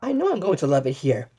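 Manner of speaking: normal talking voice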